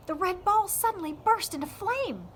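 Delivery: usual speaking voice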